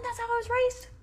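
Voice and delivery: high, tearful voice, breaking; welling up remembering her mother's voice; real crying. Real, not acted. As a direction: talk through real tears. Emotional memory of mother, crying.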